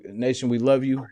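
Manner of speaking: ordinary talk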